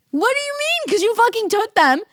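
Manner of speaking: very high, loud, incredulous squawk; mock outrage. Diner arguing with waiter over taken chia seeds, mock outraged. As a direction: protest at full pitch, comically outraged.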